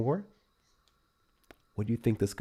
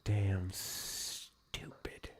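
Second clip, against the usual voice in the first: whisper; 11 dB quieter, much slower than usual. Real, not acted. Whispered aside.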